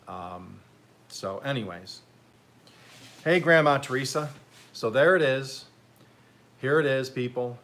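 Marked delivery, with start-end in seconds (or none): 3.2-7.6 bright, louder hello; excited greeting. Real, not acted. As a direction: call out cheerfully. Greeting grandma on camera.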